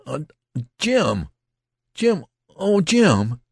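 high, loud, anguished cries of the name; stammer, then cry out in distress. A narrator's reading. Youth facing his wounded friend, stammering cries. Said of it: "He stuttered and stammered".

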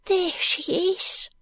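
put-on high, hushed ragged boy; street urchin whisper. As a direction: breathe it like a frightened street boy.

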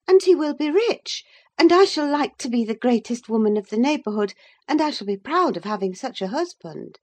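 put-on high young lady, gushing proudly; Catherine. A narrator's reading. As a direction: start high and loud, rattle it off. Catherine lists her worldly reasons.

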